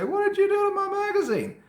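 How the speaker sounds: high, loud shocked parent's cry; upset parent. Pretend. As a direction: play the outraged mom finding her cut-up magazine. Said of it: "come home and say".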